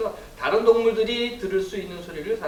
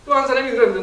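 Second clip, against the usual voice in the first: normal talking voice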